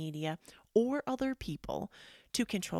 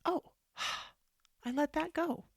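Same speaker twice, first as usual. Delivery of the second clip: relieved release; 9 semitones higher than usual. Retold or imitated. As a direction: let it go on a sigh of relief. Acting out relief after it softened.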